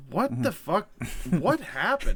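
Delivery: mock-appalled Gandalf; shocked disbelief. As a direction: react as if back to find total chaos. Acted Gandalf shocked at events.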